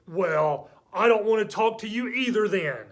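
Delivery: loud, high indignant retort; mad friend. Acted. Offended friend returning the rudeness, loudly mad. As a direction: hit back like an offended child. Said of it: "two of them who are mad".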